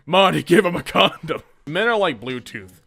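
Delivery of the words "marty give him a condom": loud, insistent order to Marty; urgent loud plea. Acted. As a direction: press Marty hard. Doc Brown style urgency.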